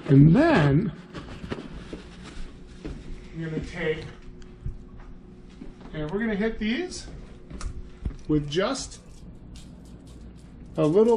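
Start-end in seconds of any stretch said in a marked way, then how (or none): none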